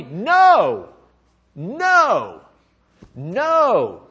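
"know" drawn out, punched, pause after each; long, ringing stress on "know"; emphatic repetition. Real, not acted. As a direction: hammer each "know" slowly and firmly. Preacher hammers contrast with feel.

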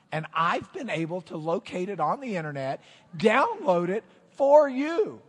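loud, high, showy build-up; announcer. Pretend. Preacher introducing a ballad to his church, grand announcer hype. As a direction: announce a grand debut, stretching the big words. Hypes a song debut like announcer.

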